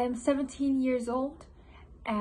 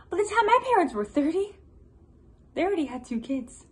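rising panic; 5 dB louder than usual. Acted. Composer measuring himself against others at thirty, rising panic. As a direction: build the comparisons into alarm.

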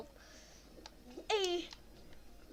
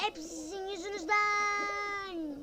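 slow, higher whining complaint; mock whiny blame; 7 semitones higher, 4 dB louder, slower than usual. Real, not acted. Gamer whining at rivals, slow and higher.

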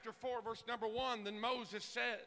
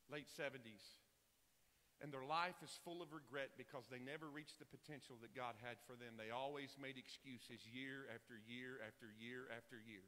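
much softer and lower, almost on one note; hushed, solemn preaching; 9 semitones lower, 9 dB quieter than usual. Real, not acted. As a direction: let it sink in as a sober warning. Preacher turns hushed and grave.

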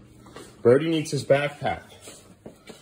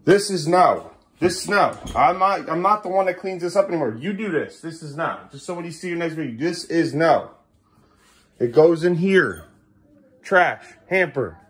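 raised, emphatic correction; firm scolding; 6 semitones higher, 3 dB louder than usual. Real, not acted. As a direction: lay down the rule word by word. Firmly correcting child about mess.

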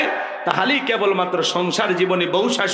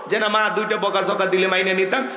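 ordinary delivery

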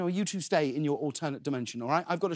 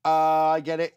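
ordinary talk

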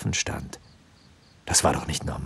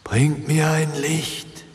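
slow, high, eerie ghostly wail; whimpering ghost; 9 semitones higher, slower than usual. A narrator's reading. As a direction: moan it like a lost spirit. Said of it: "wimmerte die Stimme".

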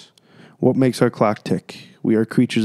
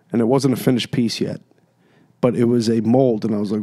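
normal talking voice

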